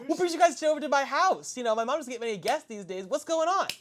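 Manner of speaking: high, bright-eyed questions; eager kid voice. Acted. Young son quizzing the guests, high and eager. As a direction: ask like a curious boy.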